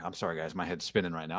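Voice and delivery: low, soft mock apology; dazed coach imitation. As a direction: imitate a coach lost for words, low and quiet. Imitates a dazed coach after game.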